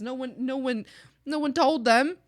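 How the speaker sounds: ordinary delivery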